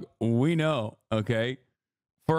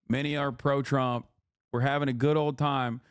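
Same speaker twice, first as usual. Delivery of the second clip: ordinary talk, nothing marked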